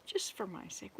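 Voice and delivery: whispered aside; a pleading genie, whispered; whispered plea. Acted. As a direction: breathe it like a secret plea.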